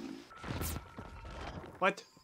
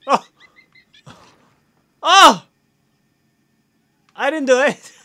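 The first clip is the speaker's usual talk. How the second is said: loud startled cry, then a frantic denial; panicked yelp; 5 semitones higher, 13 dB louder than usual. Real, not acted. Player startled by a creature, yelping in panic. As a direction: yelp and protest your innocence in a hurry.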